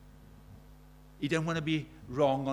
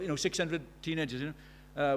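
ordinary talk

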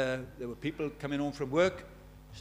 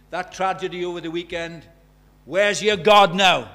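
challenging demand; 11 dB louder than usual. Retold or imitated. Woman challenges preacher in car.

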